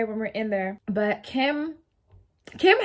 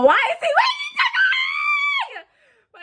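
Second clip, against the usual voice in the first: shouting much higher and fast; shocked yelp at a waving neighbor; real startled outburst; 6 dB louder than usual. Real, not acted.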